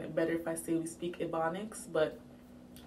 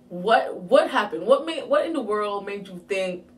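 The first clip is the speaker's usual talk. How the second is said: stunned scolding; 8 dB louder than usual. Retold or imitated. Stunned dad scolds her, retold.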